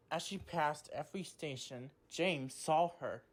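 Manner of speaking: ordinary delivery, nothing marked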